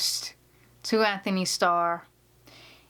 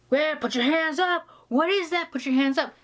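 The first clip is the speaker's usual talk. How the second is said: higher, shouted Boston cop voice; imitating a Boston cop; Boston cop accent; 7 semitones higher than usual. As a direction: bark it like a Boston detective, gun drawn. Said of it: "Nailed that Boston accent".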